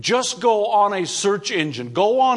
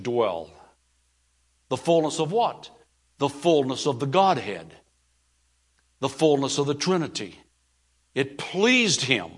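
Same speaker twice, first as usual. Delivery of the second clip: hushed scripture reading; 5 semitones lower than usual. Acted.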